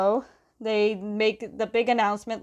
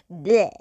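short, loud noise of mock disgust; disgusted "bleh"; 3 semitones lower, 6 dB louder than usual. Real, not acted. Narrator reacting to the couple's kiss, disgusted bleh. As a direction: blurt out a playful yuck sound. Own reaction to characters kissing.

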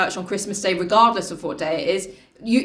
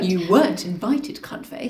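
snide; 2 semitones lower, slower than usual. Retold or imitated. Mock insult, ends unvoiced.

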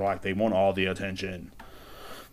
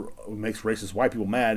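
ordinary delivery

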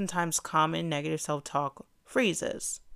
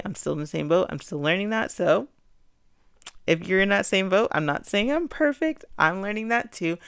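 usual speaking voice

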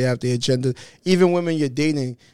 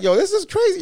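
very high, louder exclamation; pitched-up, incredulous outburst; retold amazement; 5 dB louder than usual. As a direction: act out being floored. Retelling his amazed reaction to fame.

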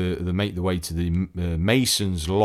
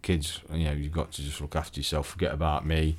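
monotone dad; 3 semitones lower, 6 dB quieter than usual. Retold or imitated. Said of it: "quite monotone with it all".

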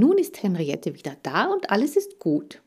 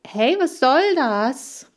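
slow, higher, louder indignant outburst; getting upset; 7 semitones higher, 5 dB louder, slower than usual. Acted. Hamster snaps at Lee over the monologue remark, indignant. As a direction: bristle at the insult. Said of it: "regte ich mich auf".

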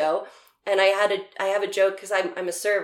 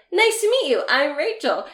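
mock polite intro; 7 semitones higher than usual. Retelling meeting a stranger.

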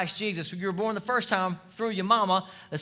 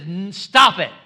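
rebuke; 11 dB louder, slower than usual. Retold or imitated. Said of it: "He rebuked them".

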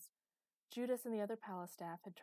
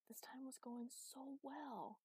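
soft, slow, higher groan; 7 semitones higher, 5 dB quieter, slower than usual. A narrator's reading. Master lamenting to Chloe, groaned. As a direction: drag it out in quiet dismay. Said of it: "groaned Master".

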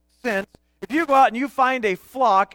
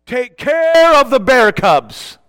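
loud, slow mock proclamation with a stretched "care"; mock grand proclamation; 2 semitones higher, 8 dB louder, slower than usual. Pastor inventing a flattering verse, loud and mock grand. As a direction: boom it out, drawing out "care". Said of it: "That would have been wonderful".

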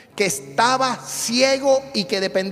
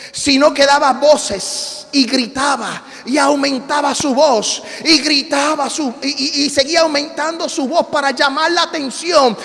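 high-pitched preaching swelling to shouts; building fervent preaching; 7 semitones higher, 7 dB louder than usual. Real, not acted. Preacher acting out crowd silencing the crying blind man, building fervent preaching. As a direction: build urgently, punching the crowd's commands louder each time.

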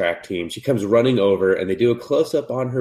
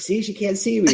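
much higher, bubbly mock girl; high girly Cupid voice; 11 semitones higher than usual. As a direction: squeak it out like a carefree cartoon girl.

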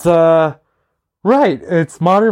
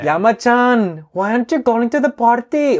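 put-on retry Colombian accent; 9 semitones higher than usual. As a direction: redo the Colombian accent after a laugh. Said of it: "No, wait, that sounds like an Indian". (コロンビア訛りのやり直し、高めでゆっくり)

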